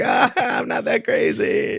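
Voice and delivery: high, slow, shouted protest; mock shout. Host imitating himself shouting back at viewers. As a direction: shout it like a playful defence. Self-quote, shouted defence.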